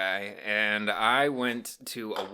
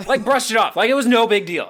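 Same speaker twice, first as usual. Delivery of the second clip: worked-up retelling; 12 semitones higher, 12 dB louder than usual. Real, not acted. Retelling shocking casual reply.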